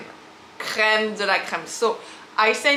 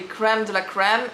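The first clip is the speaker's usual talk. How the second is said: American pronunciation. Shown as a demonstration. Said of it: "in America it's gonna be".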